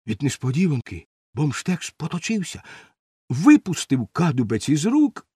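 plain reading voice

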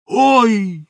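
high, loud yelp; startled cry. A narrator's reading. Helper sees tar pour into the dough, startled cry. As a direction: cry out in sudden alarm. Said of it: "скрикнув Бошбермак".

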